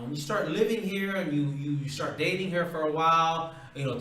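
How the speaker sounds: ordinary talk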